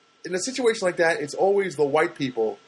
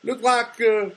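mocking bigot; 7 semitones higher, slower than usual. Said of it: "somebody came and said".

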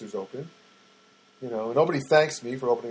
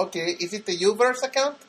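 higher imitated Filipino-accented agent; 7 semitones higher than usual. Agent checking which account, Filipino accent. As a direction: mimic a Filipino call center accent. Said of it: "she sounded Filipina".

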